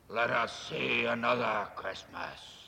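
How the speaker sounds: slow, low, measured ghostly voice; a calm spirit moving on, unhurried. Acted. Ghost leads Scrooge onward, slow and measured. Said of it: "saying as it did so".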